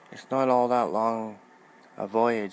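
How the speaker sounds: loud, angry retort. A narrator's reading. Gray cloak answers Potema loudly and angrily. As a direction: snap back at the queen, loud and offended. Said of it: "said one of the gray cloaks angrily".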